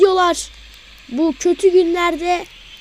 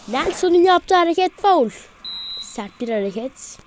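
normal talking voice

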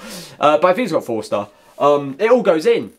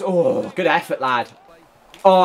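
usual speaking voice

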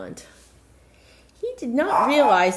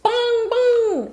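clock chime; 8 dB louder than usual. Acted. Clock chiming.

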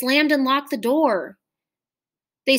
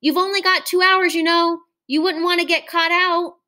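high, louder call across the field; teacher calling out; 7 semitones higher, 3 dB louder than usual. A narrator's reading. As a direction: keep calling after the running children. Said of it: "called the teacher after them".

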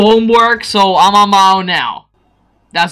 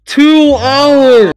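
loud, higher, drawn-out repeat; exasperated outcry; 4 semitones higher, 6 dB louder than usual. Real, not acted. Player recording a stupid game, exasperated outcry. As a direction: throw the figure out in disbelief.

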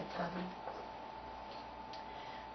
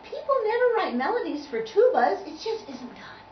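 piccolo voice; 10 semitones higher, 6 dB louder than usual. Acted. Piccolo explains.

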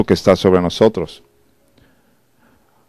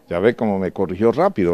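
ordinary talk

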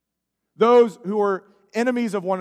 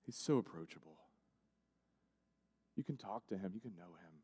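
tender hush; 13 semitones lower, 21 dB quieter, faster than usual. Acted. Tender closing appeal.

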